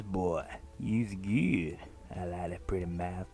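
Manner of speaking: slow, creepy drawl, low at the end; creepy sensual man. Acted. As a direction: draw it out like a leering creep. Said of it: "strokes him across the cheek very sensually".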